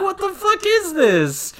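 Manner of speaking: very high, loud mock shriek; hammed-up cry of revulsion; appalled outburst. Reacting to what crawls out of his ear, appalled.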